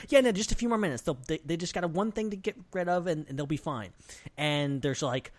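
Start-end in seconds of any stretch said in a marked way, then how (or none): 0.1-3.9 nervous manager. Retold or imitated. Hotel manager stalling the guest, high flustered reassurance. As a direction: cover the mess with a hurried smile. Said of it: "he's like".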